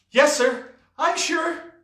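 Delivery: put-on very high-pitched boy's voice; emphatic assurance. Boy assuring the pirate there are no witches, high and emphatic. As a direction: pipe it up eagerly.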